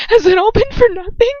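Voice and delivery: high, loud, trembling plea; a girl's voice close to tears. A narrator's reading. Girl confronting her lover, high and trembling.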